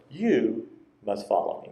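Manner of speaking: "You" lifted above "must follow me"; stress on You. Shown as a demonstration. Preacher quoting Jesus to Peter, "You" clearly stressed. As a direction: lean on "You", let the rest fall away. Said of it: "I emphasize the word".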